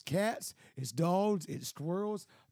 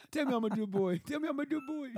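dog voice; 8 semitones higher than usual. Retold or imitated. Voicing the dog wanting praise.

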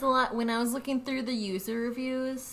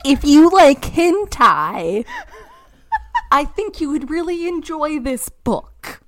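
loud announcement; 6 semitones higher, 16 dB louder than usual. Shown as a demonstration. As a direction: proclaim it loudly. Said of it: "Bitch, it's hentai".

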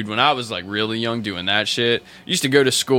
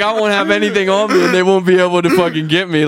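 paranoid reasoning; 8 semitones higher, 5 dB louder than usual. Retold or imitated. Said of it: "I was like".

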